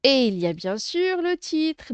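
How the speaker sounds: ordinary talk, nothing marked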